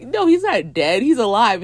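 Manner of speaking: loud, high insistence; urgent reassurance. Retold or imitated. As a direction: imitate a friend cutting through panic. Friend corrects the panicked group.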